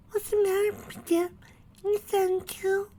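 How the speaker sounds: put-on very high, small child voice; little filly voice. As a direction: speak as a little girl pony. Dinky voiced.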